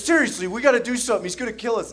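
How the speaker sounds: fast, high, loud mock panic; high, urgent, rushed alarm; panicked pleading. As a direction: plead like a scared little brother. Frightened brother pleading, acted.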